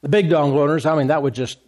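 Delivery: ordinary talk, nothing marked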